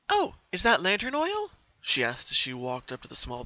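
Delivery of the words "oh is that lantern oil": high, loud pony voice, curious; a bright character voice spotting something; Pinkie voice. Acted. Pinkie noticing the bottle on the pedestal, high and curious.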